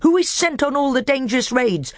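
high, sly dwarf voice pitched well up; a bitter dwarf testing the council; high, crafty dwarf; scheming dwarf. Acted.

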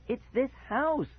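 high, slow, mock shout; desperate cry, voice cracking. Boy shouts to Dad that the house is to blame. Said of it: "I shouted, my voice cracking".

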